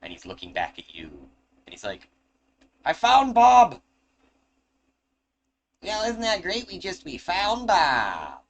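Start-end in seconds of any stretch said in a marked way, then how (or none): 2.8-3.8 high, loud squeaky gnome cry; shrill gnome glee; excited gnome. Acted. Gnome Fran shouts discovery. 5.8-8.4 high, slow gnome drawl, much higher; high-voiced gnome cheering. Gnome continues celebrating.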